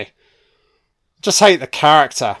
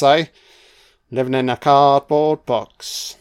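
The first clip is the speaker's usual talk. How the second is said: ordinary talk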